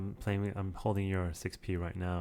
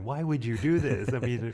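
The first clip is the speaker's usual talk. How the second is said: imitating outraged coworkers, raised and louder; drawn-out, higher-pitched mock protest; mock freaking out; 5 semitones higher, 5 dB louder than usual. Coworkers freaking out over the app shown in the office, mock alarm. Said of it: "they were freaking out going".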